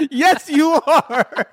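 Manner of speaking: slow, laughing insistence. Retold or imitated. Retold argument, laughing.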